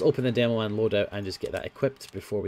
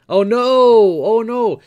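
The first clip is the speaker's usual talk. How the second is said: mock panic; 9 semitones higher, 10 dB louder than usual. Real, not acted. Last crate panic.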